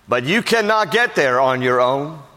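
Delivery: normal talking voice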